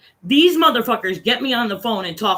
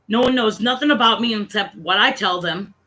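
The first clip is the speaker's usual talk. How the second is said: ordinary delivery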